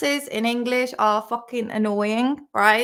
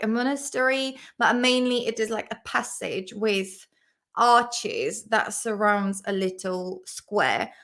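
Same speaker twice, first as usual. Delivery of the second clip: ordinary talk, nothing marked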